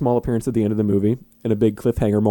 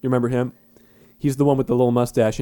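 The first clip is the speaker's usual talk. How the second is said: normal talking voice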